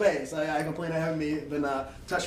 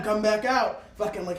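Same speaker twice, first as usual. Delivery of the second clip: usual speaking voice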